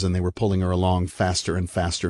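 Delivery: ordinary delivery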